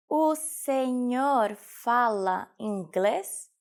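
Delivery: very slow, every word separated; slow teaching repetition. Shown as a demonstration. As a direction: stretch "O senhor fala inglês" out for learners. Teacher repeats phrase slowly for learners. (slowed-down language-lesson model)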